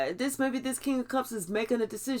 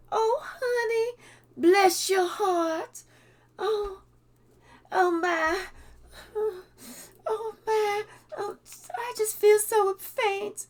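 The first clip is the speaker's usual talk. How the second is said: much higher, slow swooning southern-belle granny; faint away dramatically, all sugar; fake sweet granny; 10 semitones higher, slower than usual. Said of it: "one of those fake ass types".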